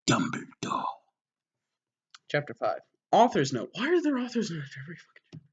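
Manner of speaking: normal talking voice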